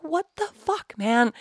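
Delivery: high-pitched, exasperated plea; retold despair. Worn-out traveler finds nobody home, near despair. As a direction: replay being at your wits' end. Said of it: "almost in despair".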